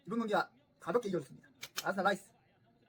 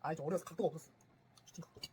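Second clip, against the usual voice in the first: usual speaking voice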